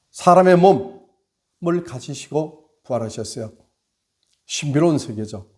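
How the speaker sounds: loud, high fervent sermon; impassioned Easter sermon climax; preaching at full force. Acted. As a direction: drive the message home like a revival preacher.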